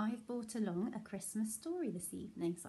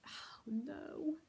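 soft, whispery concern; softly aghast storyteller; hushed dismay; 5 semitones higher, 5 dB quieter than usual. Acted. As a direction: breathe it in quiet dismay.

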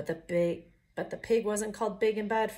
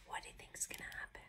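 hushed suspense whisper; storyteller whispering to the children; whispered question; 15 dB quieter, faster than usual. A narrator's reading. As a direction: lean in and whisper.

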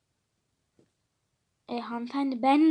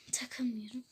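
whispered reply; 4 semitones lower, 10 dB quieter than usual. Acted.

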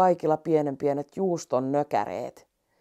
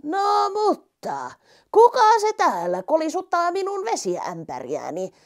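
starts in a whisper, then high and puzzled; a curious giraffe asking who is there; 10 semitones higher than usual. Acted. Giraffe voiced in the story. (kirahvin ääni, kuiskaten alkava)

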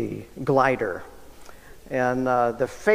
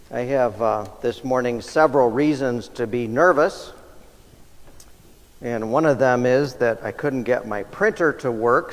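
normal talking voice

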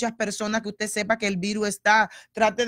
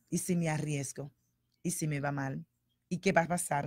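mock anxious worrying; 5 semitones lower, 8 dB quieter than usual. Voices anxious people.

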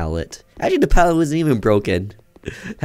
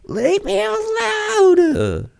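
much higher, very slow whimpering plea; mock crying wail; much slower than usual. Gamer faking terror at cardboard boxes, high crying wail. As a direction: wail it like a terrified victim, in fun.

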